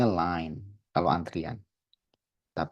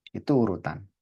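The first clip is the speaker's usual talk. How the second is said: ordinary talk, nothing marked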